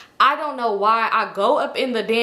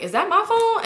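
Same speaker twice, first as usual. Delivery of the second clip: higher, puzzled retold question; startled question; 10 semitones higher than usual. Drunk girl hearing a phone buzz, high and startled. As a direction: hear a buzz and jump. Hears vibrations in bathroom.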